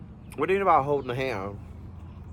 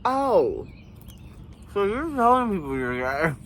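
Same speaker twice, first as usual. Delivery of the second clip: shocked realisation; 6 semitones higher than usual. Real, not acted.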